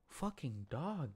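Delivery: usual speaking voice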